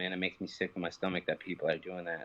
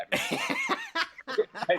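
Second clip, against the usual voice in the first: very high, slow, sing-song innocence; mock innocent; 4 dB louder, much slower than usual. Answering the Walmart lady in a high, slow, fake-sweet voice. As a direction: play it sweetly innocent, dragging it out. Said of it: "And I'm like".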